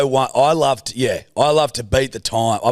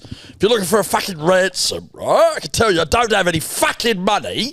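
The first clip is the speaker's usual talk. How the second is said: high, swooping gangster rant; Alfie; 5 semitones higher than usual. Acted. Gangster refusing a kidnapper ransom, high and swaggering. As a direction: punctuate the threat with combative little checks. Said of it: "Right, fucking Alfie".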